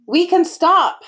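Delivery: high, loud call back; yell from ahead. A narrator's reading. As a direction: call back to those behind. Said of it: "Miles yelled from farther up".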